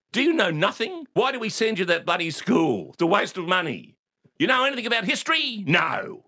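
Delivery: high, scolding imitation of dad; exasperated father. Father scolding clueless son, exasperated. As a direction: berate him like he knows nothing. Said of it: "he spoke to me like I was an idiot".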